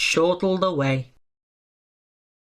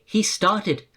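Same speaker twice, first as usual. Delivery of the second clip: usual speaking voice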